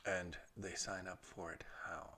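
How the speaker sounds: unvoiced whispered question. Real, not acted. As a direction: whisper the cue softly.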